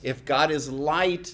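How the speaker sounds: ordinary talk, nothing marked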